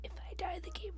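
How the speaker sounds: breathless whisper while holding breath. Real, not acted. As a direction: whisper it, barely any air. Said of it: "now she's actually holding her breath".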